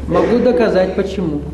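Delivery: ordinary talk, nothing marked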